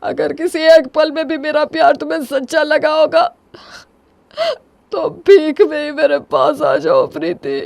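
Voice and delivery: high voice breaking into crying; tearful begging. Acted. Husband begging wife to come back to him, crying. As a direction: beg through tears.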